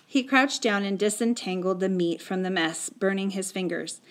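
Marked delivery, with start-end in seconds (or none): none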